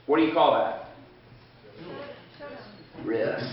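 normal talking voice

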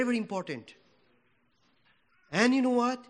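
ordinary talk

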